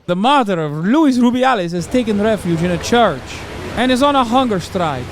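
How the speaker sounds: put-on Italian accent. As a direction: read it out in a mock Italian accent. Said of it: "I'm doing fucking Italian".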